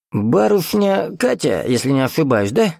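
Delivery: put-on high-pitched, flustered old man; agitated old man. A narrator's reading. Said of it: "Взбудораженно тараторил он".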